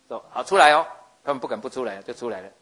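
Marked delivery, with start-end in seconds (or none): 0.1-0.8 much louder, higher summons to come out; calling out loud. Acted. God calling Aaron and Miriam out, loud summons. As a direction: call them out of the tent, loud and high.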